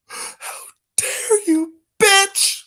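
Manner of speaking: high-pitched hammed-up indignation; mock wounded. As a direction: act scandalized and hurt, high voice. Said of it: "Should I be sad?".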